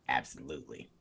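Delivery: low, quiet voice impression; [name]. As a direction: drop it low and soft, imitating the rapper. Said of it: "That's my [name] voice".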